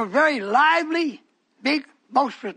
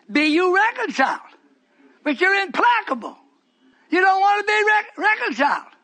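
preaching; 8 semitones higher than usual. Acted. Preacher calling the church to be reconciled. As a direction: preach it out at full force.

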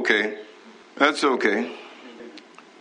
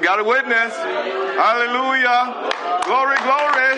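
rising praise; 7 semitones higher, slower than usual. Real, not acted. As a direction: shout it up in joyful celebration. Preacher rising into celebration.

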